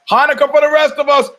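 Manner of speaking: sudden high, loud mock announcement; jokey proclamation. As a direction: belt it out as a joke.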